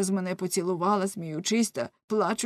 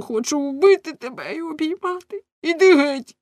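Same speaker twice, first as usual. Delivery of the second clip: much higher, loud, torn outburst; anguished shout; 9 semitones higher, 5 dB louder than usual. Acted. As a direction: explode in jealous agony. Torn husband rages. (відчайдушний крик)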